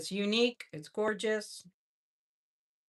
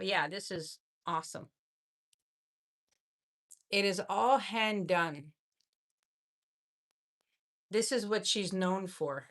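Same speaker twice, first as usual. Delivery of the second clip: ordinary talk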